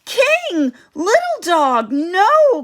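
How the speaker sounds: very high, loud and urgent; alarmed yell. A narrator's reading. Kayla yells at the dogs jumping in the lake. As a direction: stop them with a shout. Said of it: "Kayla yells".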